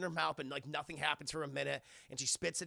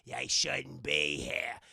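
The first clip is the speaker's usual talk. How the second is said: low ghost voice; 7 semitones lower, 3 dB louder, much slower than usual. Retold or imitated. Ghost Bill voice.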